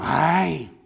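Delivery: hammed-up yelp of hurt feelings; mock ouch. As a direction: fake wounded cry. Mocking hurt church members.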